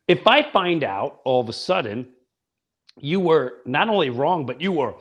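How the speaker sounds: ordinary delivery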